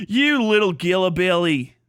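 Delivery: goofy Aussie insult; exaggerated Australian impression; mock Australian accent. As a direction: toss it out like a caricature Australian. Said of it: "goofy shit from wherever the fuck you're from".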